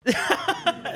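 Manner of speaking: high burst of laughter; loud laugh. Real, not acted. Laughs at the messy studio.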